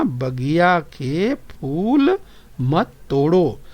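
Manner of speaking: very slow, पर stretched long on फूल; slow word-by-word reading. Shown as a demonstration. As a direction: spell out the phrase word by word. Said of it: "आपने सुना".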